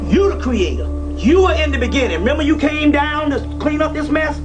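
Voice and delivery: normal talking voice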